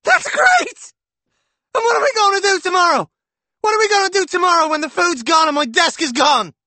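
very high, loud rapid yelling; furious sarcasm. A narrator's reading. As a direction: rant it out furiously. Husband yelling in quarrel.

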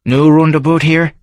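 gruff Edinburgh local sizing up a stranger; Davy's Scots brogue; Scottish barfly. Acted.

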